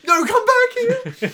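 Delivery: shrieked, very high and loud; hammed-up yell after someone fleeing; mock desperate shout. Stand screams after the fishes as they leave, mock desperate shout.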